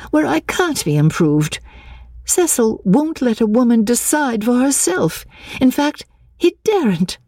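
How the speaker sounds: high voice, hesitant then indignant; agitated heroine, pitched high; flustered young woman. Acted. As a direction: confide haltingly, rising into complaint.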